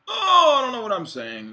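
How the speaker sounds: high, loud wail at oneself; exasperated outburst. Real, not acted. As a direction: burst out loud and high, giving up on the rant. Exclaims over own rambling rant.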